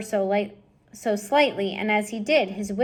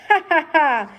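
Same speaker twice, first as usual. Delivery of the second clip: loud, high spaced laugh; mocking laugh; 7 semitones higher, 7 dB louder than usual. Botticelli laughing at the fight, loud mocking laugh. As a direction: laugh from the sidelines. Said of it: "Botticelli laughed".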